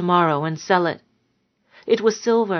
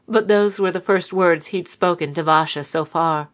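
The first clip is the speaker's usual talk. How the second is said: plain reading voice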